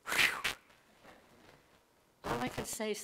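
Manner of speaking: normal talking voice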